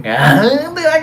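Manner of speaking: usual speaking voice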